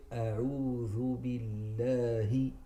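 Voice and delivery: "A'udhu billahi" very slow, the double l held; correct slow recitation. Shown as a demonstration. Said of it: "So we say".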